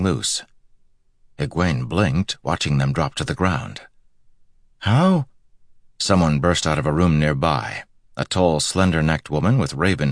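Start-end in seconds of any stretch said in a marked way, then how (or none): 4.8-5.3 very high one-word question; astonished. A narrator's reading. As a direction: ask in sheer disbelief. Egwene amazed the ropes fell.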